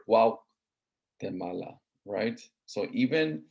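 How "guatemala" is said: Guatemala stretched slowly syllable by syllable; teacher lingering on Guatemala; slow, drawn out. Shown as a demonstration. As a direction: draw Guatemala out to show its Nahuatl roots. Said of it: "is actually a Nahuatl word".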